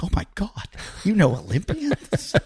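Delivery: breathless awe of a young fan, lightly imitated; excited niece. Retold excited little niece.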